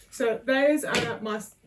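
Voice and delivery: usual speaking voice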